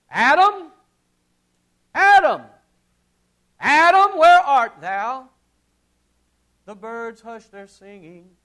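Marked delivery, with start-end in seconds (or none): 0.1-5.3 loud, high, slow calls of Adam; booming call from the song. Retold or imitated. God calling Adam in the old song, booming. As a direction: cry the name three times. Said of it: "And it goes".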